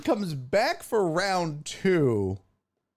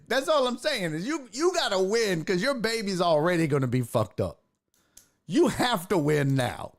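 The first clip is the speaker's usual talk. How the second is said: ordinary talk, nothing marked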